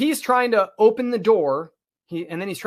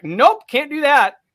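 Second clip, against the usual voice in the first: higher, louder and slow, a rugged farmer flatly refusing; rugged Montana farmer; 8 semitones higher, 7 dB louder, slower than usual. Retold or imitated. Farmer refusing to fetch his wife, higher, louder, slow. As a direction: play a stubborn Montana farmer turning him down. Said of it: "rugged ass fucking Montanan farmer".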